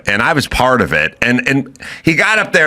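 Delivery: ordinary talk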